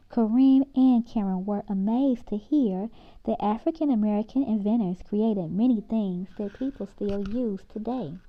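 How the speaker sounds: usual speaking voice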